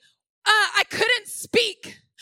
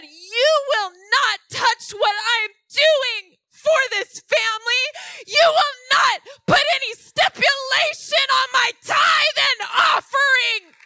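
loud, high commanding rebuke; rebuking shout; 7 semitones higher, 6 dB louder than usual. Retold or imitated. Rebuking the spirit over her tithe, loud commanding shout. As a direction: forbid the spirit, full force. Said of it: "And I said".

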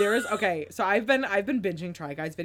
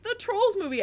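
put-on little girls voice; 13 semitones higher than usual. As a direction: chirp it like small girls. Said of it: "They're all like".

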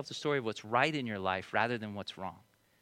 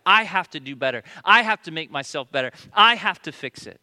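louder, insistent sermon build; fervent preaching; 2 semitones higher, 10 dB louder than usual. Acted. Pastor urging the congregation, fervent preaching. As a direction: press each line harder.

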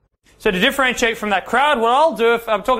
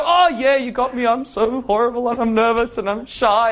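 sheepish confession; 3 semitones higher than usual. Acted. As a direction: play it as a mock-nervous cave-in when caught out. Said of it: "it's how you phrase it".

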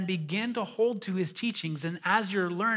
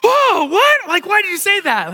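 much higher and louder startled outburst; shocked son; 13 semitones higher, 12 dB louder, slower than usual. Retold or imitated. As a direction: react like a kid who just heard his dad say something shocking. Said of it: "And he was like".

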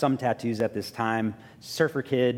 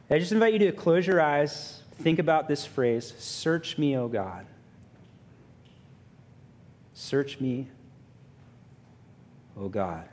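reflective prayer. Real, not acted. Leads closing reflection prayer.

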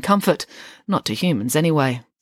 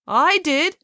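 woman speaking up; 11 semitones higher, 4 dB louder than usual. A narrator's reading.

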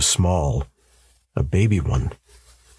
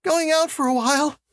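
much higher, wavering woman's voice. A narrator's reading. Mother calling her twins downstairs, wavering voice. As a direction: call to the children with a waver. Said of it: "Janet called in a wavering voice".

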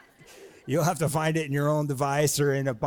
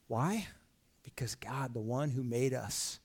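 hushed answer; 3 semitones lower, 5 dB quieter, slower than usual. Acted. Hushed answer after the hype.

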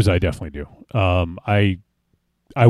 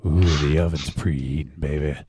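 sultry seductive voice; 6 semitones lower, 5 dB quieter than usual. Pretend. Mock sexy pizza ad come-on.

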